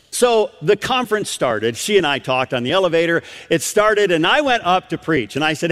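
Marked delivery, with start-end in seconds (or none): none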